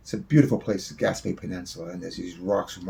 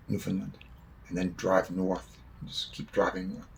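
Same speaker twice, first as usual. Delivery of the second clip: usual speaking voice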